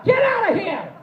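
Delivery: high-pitched hammed-up shouting at the brawlers; high, loud mock outburst outside the movie theater; mock tough yell. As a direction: yell at them like a panicking hero, way up high. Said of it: "you're like".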